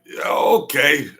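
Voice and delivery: loud, slow mock agreement; mock shout. To Sam, a loud slow mock yes. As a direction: bellow a sarcastic yeah okay. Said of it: "I'm like".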